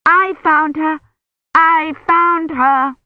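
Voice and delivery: put-on high, loud child's shout. A narrator's reading. Girl tells the others she found Kivrin, shouting. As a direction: shout the news back to the others.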